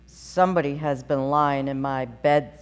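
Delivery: low, loud gruff papa bear; great rough gruff voice. A narrator's reading. Papa bear finds his bed disturbed, low and gruff. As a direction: growl it big and low. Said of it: "in his great rough, gruff voice".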